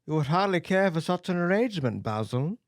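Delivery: usual speaking voice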